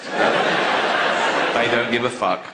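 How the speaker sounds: low and drawn-out, no remorse; slow, low mock cat. To the audience, about cats: low, unbothered cat drawl. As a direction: drag it out like a heartless cat. Cat's attitude voiced.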